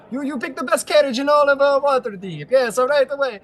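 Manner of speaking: high, loud gnome cabbie voice; eager little Italian driver, pitched high. Acted. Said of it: "I think I gotta make their voices a little more shrill".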